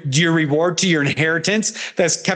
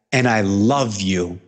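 tender; 10 semitones lower, slower than usual. Acted. God voiced tenderly to sinner.

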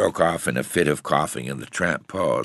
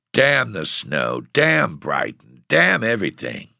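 each damn slow and heavy, building louder; slow grim curses; 6 semitones higher, 5 dB louder than usual. A narrator's reading. Tramp curses snow and Brighton, slow and grim. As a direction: spit out the curses one by one, slowly. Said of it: "continued grimly".

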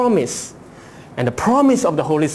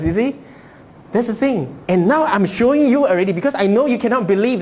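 ordinary delivery

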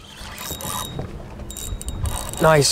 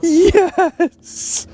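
excited shout. Real, not acted. Excited catch celebration.

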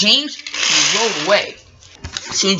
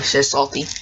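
ordinary delivery, nothing marked

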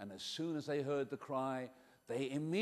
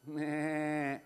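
a drawn-out sheep meh; sheep bleat. Acted. Boy imitating the sheep for the children, bleating. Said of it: "Do you know what sheep do?".

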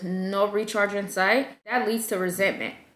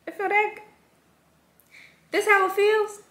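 much higher, wondering question; amazed discovery; 11 semitones higher than usual. Retold or imitated. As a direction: marvel at the feeling. Discovering self-care feels good.

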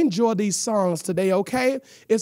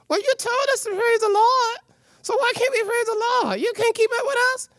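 much higher, quick cheeky puppet voice; a pert puppet talking back, fast; sassy puppet voice; 11 semitones higher than usual. Acted. Puppet teasing the host about praising, in a high cheeky voice.

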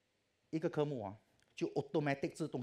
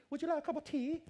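high, loud mock English lady with a laugh; English tea offer; 3 dB louder than usual. Teller mocks how the English always offer tea, high and laughing. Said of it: "每次他们开头句".

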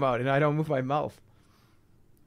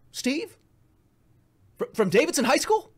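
high, questioning surprise; mock recognition; 12 semitones higher, 3 dB louder than usual. Approaching a stranger as an old schoolmate, mock surprise. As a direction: act out a hesitant, high-pitched recognition.

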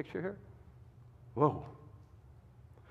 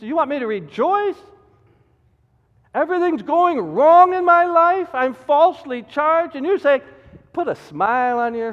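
mock indignation; 10 semitones higher, 9 dB louder than usual. Voices an outraged believer.

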